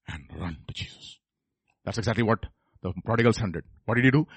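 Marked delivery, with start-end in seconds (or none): 0.1-1.2 near-whisper, very low and soft; whispered exhortation. Acted. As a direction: let it sink to a hush. Hushed close of three acts.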